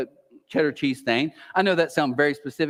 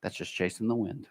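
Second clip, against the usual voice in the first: soft; 5 semitones lower, 10 dB quieter than usual. Shown as a demonstration. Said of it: "I always whisper back".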